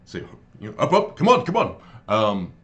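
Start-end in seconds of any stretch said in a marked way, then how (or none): 0.8-1.8 high, loud burst of urging; Croesus urging. Acted. Game master voices Croesus hurrying companion along, high and urgent. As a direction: chivvy them along, pitched up and loud. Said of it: "as Croesus, you, you hear him".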